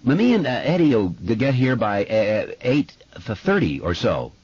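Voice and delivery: put-on boy stammering on first sounds; stuttering. A narrator's reading. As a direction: catch on each first consonant. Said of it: "Did you notice that he stutters?".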